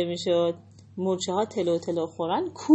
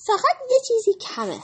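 high little bunny voice; little rabbit; 14 semitones higher, 4 dB louder than usual. Acted. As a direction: chirp it like a small rabbit. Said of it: "خرگوش کوچولو گفت". (صدای زیر خرگوش کوچولو)

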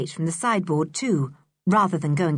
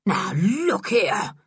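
slow, drawn-out protest; blustering catchphrase; much slower than usual. A narrator's reading. Man left with nothing else to say, slow bluster. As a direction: stretch out a helpless objection. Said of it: "nothing to say except his favourite".

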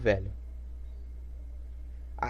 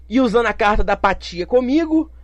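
usual speaking voice

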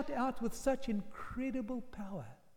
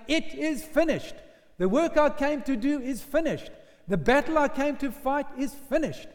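higher, louder triumphant declaration; shout of victory; 3 semitones higher, 6 dB louder than usual. Acted. Preacher voicing Jesus proclaiming victory, triumphant shout. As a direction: proclaim the win over Satan. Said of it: "now he shouts in triumph".

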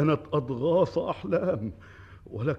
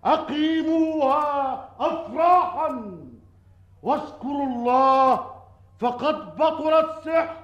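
royal proclamation; 5 semitones higher, 5 dB louder than usual. Acted. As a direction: declaim it to the whole crowd. King proclaims to the people.

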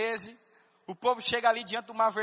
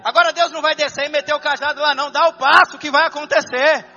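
loud, higher, fervent sermon climax; full-force preaching; 4 semitones higher, 11 dB louder than usual. Acted. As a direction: preach it out to the whole church. (pregação inflamada, em alta voz)

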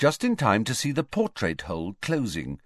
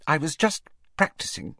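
brittle voice; 6 semitones higher than usual. A narrator's reading. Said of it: "she said in a brittle voice".

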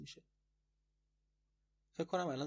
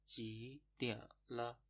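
slow; much slower than usual. Shown as a demonstration. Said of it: "خب پس شد".

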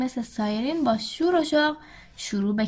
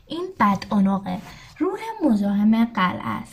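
ordinary delivery

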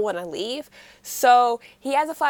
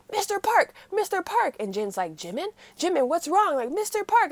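calling nurses; 8 semitones higher than usual. Retold or imitated. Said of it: "And they're like".

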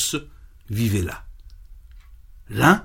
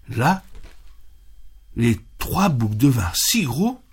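usual speaking voice

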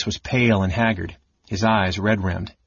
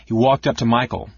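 plain reading voice

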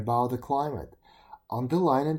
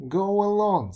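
loud, higher-pitched send-off; brusque dismissal; 6 semitones higher, 7 dB louder than usual. A narrator's reading. Smith sends the complaining beetle away, loud dismissal. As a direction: wave him away with a raised voice.

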